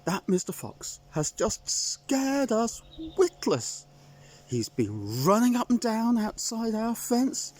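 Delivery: high, fretful hen voice, near tears; a fussing chicken in a flap; high worried chicken; flustered hen. Acted.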